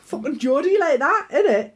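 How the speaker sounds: ordinary delivery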